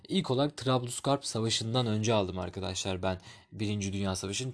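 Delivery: ordinary talk, nothing marked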